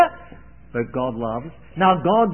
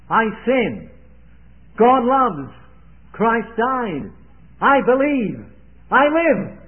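each phrase higher and louder, building to a peak; rising proclamation; 6 semitones higher, 6 dB louder, slower than usual. Acted. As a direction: count it off on your fingers, climbing. Finger lesson, building climax.

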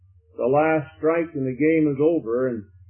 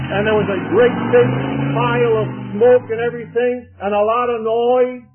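excited play-by-play; 6 semitones higher than usual. A narrator's reading. Preacher tells the race to home plate, excited play-by-play. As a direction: whip up the suspense of a photo finish. Climax of the retold game.

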